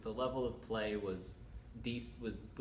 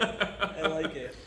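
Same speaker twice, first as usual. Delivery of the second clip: laughing; 4 semitones higher, 7 dB louder, much slower than usual. Real, not acted. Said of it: "laughing repeat".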